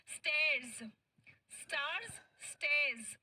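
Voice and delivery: "स्टेयर्स" with ay sound set against "स्टार्स" with long a; repeat after me. Shown as a demonstration. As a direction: drill the words one by one. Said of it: "सभी बच्चे मेरे साथ में pronounce करें". (बच्चों के साथ उच्चारण अभ्यास)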